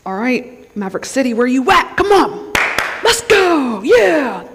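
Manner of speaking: building from casual call to high whoop; mock hyped-up. Preacher playing feelers who need hype music, mock excited. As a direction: whoop like a worship fan, hammed-up. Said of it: "we need to be hyped up".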